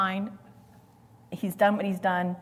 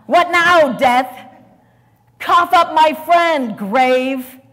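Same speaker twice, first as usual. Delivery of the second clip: high, loud triumphant taunt at death; defiant shout; 8 semitones higher, 13 dB louder, much slower than usual. Acted. Jesus taunting death and the grave, triumphant shout.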